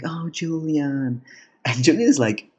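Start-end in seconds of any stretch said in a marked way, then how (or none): 0.0-1.2 high, slow, lightly voiced swoon; swooning drunk. Drunk Killian greeting Julian lovingly, mild swoon. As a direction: lightly sigh his name, smitten. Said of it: "He's like".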